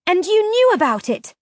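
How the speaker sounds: high, loud yell of accusation; furious shout. A narrator's reading. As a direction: yell it in disbelief. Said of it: "I yelled".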